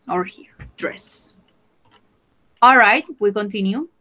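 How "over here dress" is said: whispered. Shown as a demonstration. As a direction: whisper it, unvoiced and soft. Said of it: "teacher whispers words".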